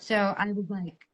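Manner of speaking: ordinary delivery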